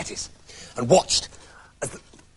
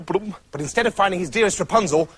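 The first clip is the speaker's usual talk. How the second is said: usual speaking voice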